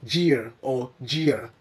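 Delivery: "gear" with a soft j, like jeer; mispronounced gear. Shown as a demonstration. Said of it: "Don't say".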